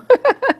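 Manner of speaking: high bright burst of laughter; quick loud laugh. Real, not acted. Reacts to hungry comment.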